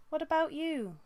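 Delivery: high, anxious apprentice voice; nervous. Acted. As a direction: ask it nervously, a young cat unsure. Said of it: "sounded nervous".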